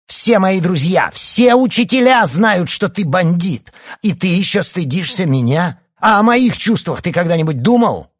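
high, loud enraged tirade; outraged son shouting; furious shouting. A narrator's reading. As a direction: yell it at your father in full fury. Son rages at his father.

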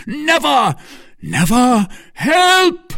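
desperate high shout for help; loud, very high cries. A narrator's reading. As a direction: shout defiance, rising into alarm. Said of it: "shouted the Duke".

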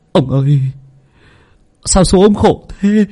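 high, tearful wail, half sobbing; whimpering servant. Acted. Servant tearfully telling master his wife died. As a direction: cry out the news about to weep. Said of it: "mếu máo".

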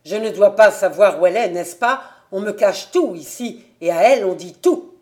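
loud, hurried irritated retort. A narrator's reading. Invalid son accusing his father of hiding things, irritated. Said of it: "la voix plus haute et plus irritée".

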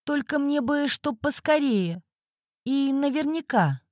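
high, anxious woman, pleading; pleading client. Acted. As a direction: beg for it to be quick and certain. Client pleads for quick results.

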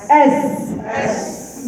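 "أس" with a long hissed S and air flowing; hissing S demo. Shown as a demonstration. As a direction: stretch the S in "أس" into a long soft hiss. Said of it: "لما أقول لك مثلًا".

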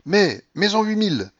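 high, loud gambler's demand; urgent order. A narrator's reading. Grandmother demanding a bigger stake, urgent.

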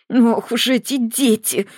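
slow, strained hiss through clenched teeth. A narrator's reading. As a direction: squeeze it out through gritted teeth. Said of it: "прошипела Маргарита сквозь стиснутые зубы".

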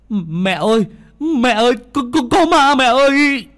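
put-on terrified child cry. Boy sees a ghost and screams for his mother in terror. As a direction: scream for mom after seeing a ghost. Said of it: "thì kêu lên".

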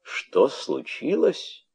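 high, slow bitter echo; mock their question bitterly; slow, high bitter mimicry; unheard-of bitterness. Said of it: "с неслыханной горечью".

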